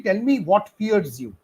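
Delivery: ordinary talk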